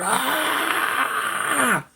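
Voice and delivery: long high-pitched "aah" cry; retold holler. Brother grabbing the gun, acted-out holler. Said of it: "He hollered".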